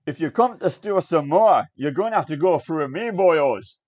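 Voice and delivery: booming dwarf smith calling out; gruff threat shouted from inside; deep voice. Acted. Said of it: "a deep voice call out from the building".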